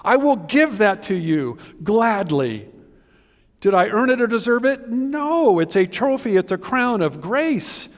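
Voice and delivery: loud, high preaching, building fervor; full-force preaching. Acted. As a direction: preach it out to the whole church.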